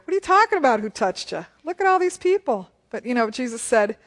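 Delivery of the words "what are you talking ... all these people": high-pitched, incredulous disciple; mock exasperated protest, pitch jumping up; Peter. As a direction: question him in loud disbelief. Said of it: "there's Peter".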